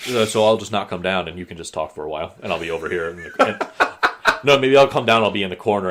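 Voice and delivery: ordinary delivery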